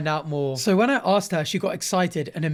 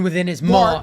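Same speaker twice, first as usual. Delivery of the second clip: "Mark" hit hard and louder, falling; stress on Mark; 6 dB louder than usual. Acted. Naming the true villain, loud stress on "Mark". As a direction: land on "Mark" like a reveal.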